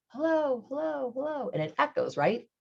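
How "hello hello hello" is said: fading echo, high and distant. Retold or imitated. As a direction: let it fade away like an echo. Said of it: "guess what it says back".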